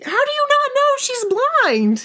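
high, slow, incredulous squeal; coworker's high mock-shocked question; shocked disbelief. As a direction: stretch it out in disbelief. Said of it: "She was like".

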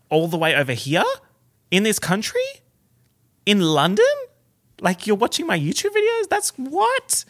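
high, loud astonished questioning; incredulous disbelief. Retold or imitated. Vlogger reliving being recognised in London, incredulous. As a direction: voice shoots up in utter disbelief. Acts out astonished reaction to fans.

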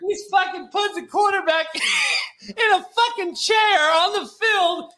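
can barely get the words out for laughing; laughing hard. Real, not acted. Host cracking up while telling his co-host about the tape. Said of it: "I'm laughing so fucking hard".

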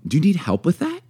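helpful, light question; friendly offer. Shown as a demonstration. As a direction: offer help lightly, lifting at the end. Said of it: "just how you say it".

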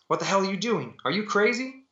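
high-pitched, incredulous protest; gasping alarm. A narrator's reading. Frank to the woman with the revolver, high gasping alarm. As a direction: pitch it up in shocked disbelief. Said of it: "He gasped".

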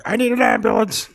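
high, strained cry for help; mock wounded plea. Gangster coughing up blood after being shot, mock wounded plea. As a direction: plead as if badly hurt, play-acted. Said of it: "He's coughing up blood".

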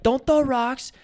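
loud high warning shout; shouting at kids. Retold or imitated. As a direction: call up the hill to stop them. Yelling at kids.

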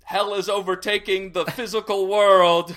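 loud, high street-corner paper cry; a hammed-up old-time newsboy yelling the news; newsboy hawking. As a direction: shout the headline like a newsie selling papers.

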